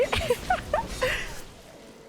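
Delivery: high laugh trailing down; real helpless laughter; laughing hard. Real, not acted. Laughs at the mishap.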